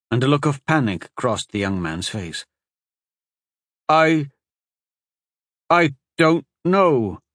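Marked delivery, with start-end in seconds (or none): 3.9-7.2 halting, slow and panicked. Acted. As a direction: falter as fear rises. Panicked man cannot remember.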